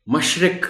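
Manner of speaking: مشرق with a short i in the second syllable, mash-riq; correct pronunciation. Shown as a demonstration. Teacher modelling مشرق for teachers, clear i in the second syllable. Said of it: "آپ نے اس کا درست تلفظ".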